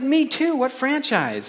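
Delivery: high, thrilled agreement; excited, soaring voice; delighted. Acted. Joke: delighted at shared faith.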